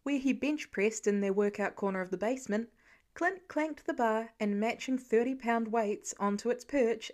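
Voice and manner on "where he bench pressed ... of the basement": usual speaking voice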